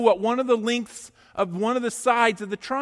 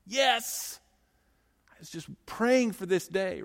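secret inner glee. Retold or imitated. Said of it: "inside you're going".

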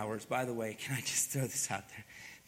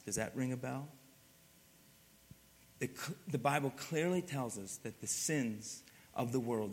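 ordinary talk

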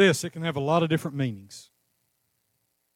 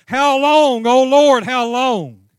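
high, loud anguished plea to the Lord; crying out to God; 6 semitones higher, 9 dB louder, much slower than usual. Retold or imitated. Preacher voicing people crying out to God, loud plea. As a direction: cry it out to heaven, pleading. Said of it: "and we still cry out".

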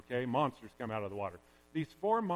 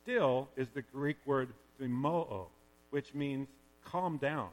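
normal talking voice

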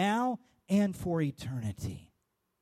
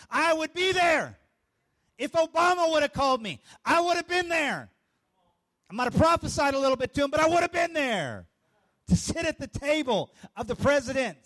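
raised, louder insistence; emphatic preaching; 7 semitones higher, 5 dB louder than usual. Acted. Pastor insisting to the congregation he'd be there, emphatic. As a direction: declare it with conviction, voice up. Preacher declaring he would attend.

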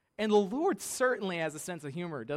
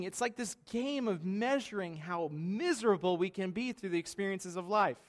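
usual speaking voice

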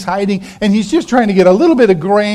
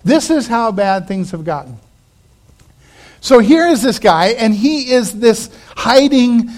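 ordinary delivery

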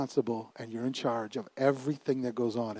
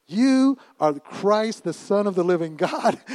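slow, high, ringing proclamation; preaching declaration; 4 semitones higher, slower than usual. Acted. Peter boldly declaring to Jesus, slow preaching proclamation. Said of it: "Peter starts preaching".

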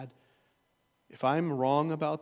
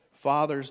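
louder punched call-out; emphatic address; 9 dB louder than usual. Real, not acted. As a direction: hit the word Fathers to call out the men in the pews. Preacher addresses fathers directly.

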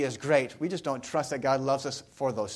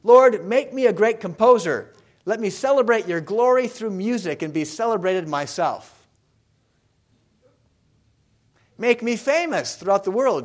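loud, higher, fervent plea to God; boy's bargaining prayer; 5 semitones higher, 7 dB louder than usual. Acted. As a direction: plead grandly as an ambitious young composer. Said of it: "tries to make a bargain with God".

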